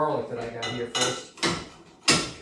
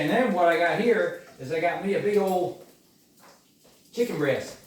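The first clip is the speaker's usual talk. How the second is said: usual speaking voice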